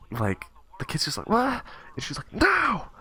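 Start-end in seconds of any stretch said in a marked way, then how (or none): 1.3-1.6 very high-pitched mock-shocked yelp; bewildered kid. Bewildered kid answers angry teacher. 2.3-2.9 very high-pitched screamed command; mock teacher scream. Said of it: "She just screams at him".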